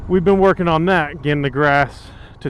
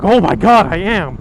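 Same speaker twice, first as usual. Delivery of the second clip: sarcastic; 3 semitones higher, 6 dB louder than usual. Retold or imitated. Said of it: "I wanted to be sarcastic".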